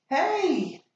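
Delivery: loud high shout; sudden shouted call. Acted. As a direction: yell it from behind. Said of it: "he heard a shout behind him".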